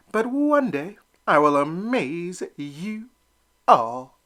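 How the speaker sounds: slow, rising to loud, firm promise; defiant vow. Acted. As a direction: face the bully down. Elmer stands up to Drake.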